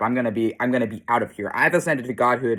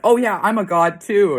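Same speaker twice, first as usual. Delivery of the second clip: mock boast; 8 semitones higher, 6 dB louder than usual. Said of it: "all these people be like".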